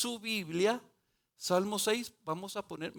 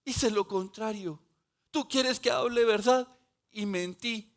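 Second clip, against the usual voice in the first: louder, higher anguished confession to the Lord; pleading prayer; 4 semitones higher, 5 dB louder than usual. Retold or imitated. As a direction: cry out the prayer as a repentant sinner. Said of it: "me hace ver".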